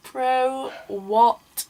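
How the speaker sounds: mock-outraged bro, what; indignant bro what.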